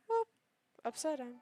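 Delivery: ordinary talk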